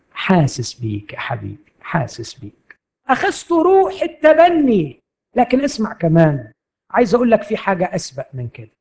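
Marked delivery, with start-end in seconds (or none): 0.1-2.8 tender father. Acted. The Father comforts his hurting son.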